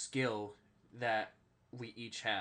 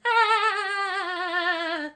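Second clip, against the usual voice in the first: cartoon laugh; 13 dB louder than usual. Shown as a demonstration. Cartoon laugh after Patrick bit.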